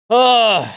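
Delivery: loud, high groan of pure frustration; angry groan. Real, not acted. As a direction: let out a furious groan. Raging over losing streak.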